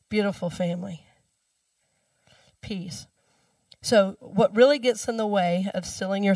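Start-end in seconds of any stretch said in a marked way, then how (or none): 2.6-3.1 soft mantra. Shown as a demonstration. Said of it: "mantra repeated after story".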